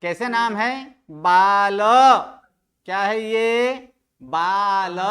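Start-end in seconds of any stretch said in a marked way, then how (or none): 1.1-1.8 बाल as baa-la, loud, with a full open a at the end; final vowel sounded. Shown as a demonstration. Teacher drilling the noun's ending, full final vowel. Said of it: "अ का उच्चारण करना है". 4.2-5.0 बाल as two drawn-out syllables, baa-la; final vowel sounded. Shown as a demonstration. Teacher repeating the noun for students, full final vowel. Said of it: "अ का उच्चारण करना है".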